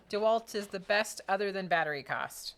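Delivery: normal talking voice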